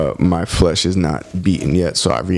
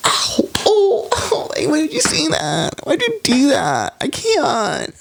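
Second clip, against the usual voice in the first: much higher squeaky cartoon voice, laughing; high squeaky mock voice; slower than usual. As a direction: play a squeaky hurt little voice.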